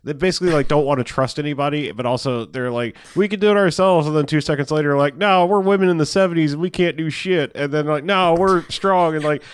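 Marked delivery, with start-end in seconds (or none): 3.2-4.1 higher, louder mock confidence; mock bravado. Mocking the daughters' claim with a high louder voice. 5.2-7.5 higher, louder mock whine; mock helplessness. Mocking the daughters giving up, higher and louder. 8.0-9.4 higher, louder hammed-up daughters; mock flip-flopping. Mocking the daughters' back-and-forth in a higher, louder voice.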